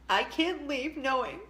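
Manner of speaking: tearful, high and breaking voice; crying. Real, not acted. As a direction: choke up through the words. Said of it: "this could make me cry".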